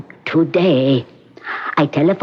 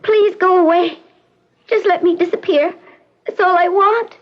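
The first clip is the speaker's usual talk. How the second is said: high, weary pleading voice; pleading girl. Acted. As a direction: beg to be left alone. Patient begs to disappear.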